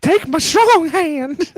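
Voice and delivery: high, loud, slow comic impression; mock character voice. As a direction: stretch it out in a strained silly voice. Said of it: "You know what he looks like?".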